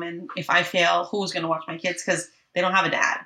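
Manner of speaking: ordinary talk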